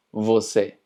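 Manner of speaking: você said "vo-SEH" with a buzzing v; você with a clear lip-teeth v; Portuguese você. Shown as a demonstration. Teacher modelling você for Spanish speakers, buzzing v. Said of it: "en portugués se pronuncia".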